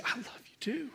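high, very soft whispered reply; imitated tearful whisper. Husband weeping in his wife's arms, imitated tearful whisper. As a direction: whisper it as an overcome husband would. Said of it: "crying, weeping in her arms".

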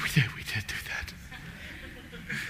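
very soft, slow, near-whispered protest; mock meek protest. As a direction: mimic a meek little objection under the breath. Acting out listeners' doubtful reaction.

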